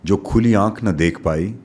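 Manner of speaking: slowing on the closing lines of each verse; poetic recitation. Acted. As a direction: recite it like a poem to an audience.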